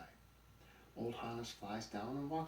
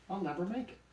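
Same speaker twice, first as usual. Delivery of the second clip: high, loud whine; despairing complaint; 12 semitones higher, 6 dB louder, faster than usual. A narrator's reading. Donkey dreading the steep road, high and despairing. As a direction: wail it as a hammed-up protest.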